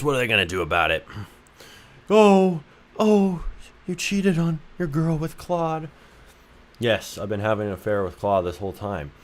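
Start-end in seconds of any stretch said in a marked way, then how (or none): 2.1-5.9 high, slow gossipy gasp; hammed-up scandalized high voice; mock gasp. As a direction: clutch your pearls in a high squeal. Mock voicing chat gasp.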